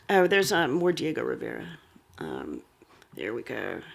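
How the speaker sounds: low, very soft aside to oneself; quiet, absent-minded aside while sorting something out; muttered aside. Real, not acted. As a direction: drop right down, as if talking to yourself.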